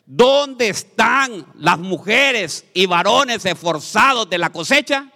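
loud, slow rallying call to the church; preaching shout. Real, not acted. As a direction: call them out, drawn out and loud. Preacher calls out to congregation.

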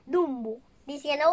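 ordinary delivery, nothing marked

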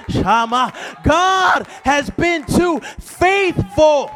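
loud, high, driving sermon shout; full-force preaching. Acted. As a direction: preach it full out to the congregation, stretching key words.

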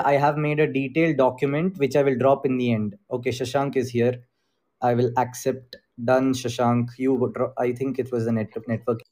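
ordinary talk

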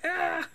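high yelp before laughing; playful shriek of fright; imitating a jump-scare scream; mock startled scream. Retold scream at ghost mother.

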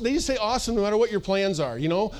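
ordinary talk

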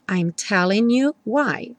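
slow, "telling" N joined to "you"; linked telling you. Shown as a demonstration. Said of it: "o N do telling junta com you". (N de "telling" ligado ao "you")

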